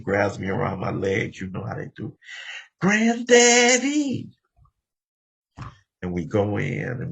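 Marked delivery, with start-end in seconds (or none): 2.8-4.3 put-on very high, loud, drawn-out child's cry; excited little grandson. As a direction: squeal it like a small boy grabbing grandpa's legs. Little Aaron hugging his legs.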